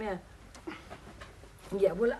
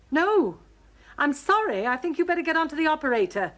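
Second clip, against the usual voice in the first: higher, firm and irritated; strained politeness, pitched up; exasperated; 7 semitones higher than usual. Acted. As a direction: cut off a wrong-number caller crossly. Irritated by wrong number, says furious.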